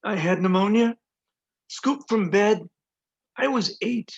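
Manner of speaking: emphatic poetry voice with pauses between images; poem reading. Acted. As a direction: recite in short, weighted phrases. Begins reading second poem.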